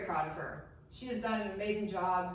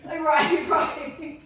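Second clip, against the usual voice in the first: laughing; 6 semitones higher, 10 dB louder, much slower than usual. Real, not acted. Laughing with audience.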